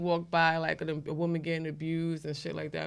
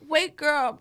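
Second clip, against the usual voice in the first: imitating a man calling; 9 semitones higher, 8 dB louder than usual. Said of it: "he like".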